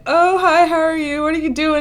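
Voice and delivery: high, chirpy retold hello; friendly acted greeting. Neighbour greeting her as she heads out, friendly high imitation.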